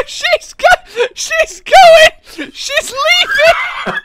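high, laughing cry; high-pitched giddy exclaiming; excited squeal. Real, not acted. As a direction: squeal it helplessly through laughter. Excited cry as monster leaves.

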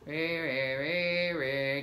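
low, wordless mock grumble; imitated surly muttering; grumbling worker. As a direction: drone like a grouchy server. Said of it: "He'd be like, they're like".

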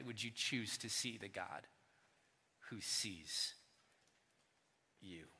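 low, hushed invitation; gentle hushed question; soft appeal. Acted. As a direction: invite them quietly.

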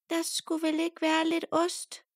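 high squeaky mouse asking; little mouse voice. A narrator's reading. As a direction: lift up into a tiny mouse. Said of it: "spørger musen".

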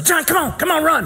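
high, urgent sideline urging; urgent coaching call. Retold or imitated. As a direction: imitate a coach urging a runner on. Acts out coach urging slow runner.

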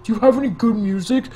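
imitating Jerry's loud gripe; annoyed demand. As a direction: bark at him to change the music. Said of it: "He's like".

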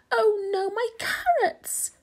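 high, cracking wail; crying out. A narrator's reading. Rabbit loses his carrots in the flood, crying out. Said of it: "cried Rabbit".

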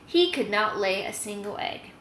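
plain reading voice

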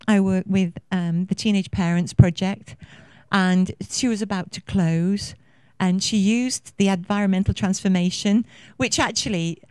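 normal talking voice

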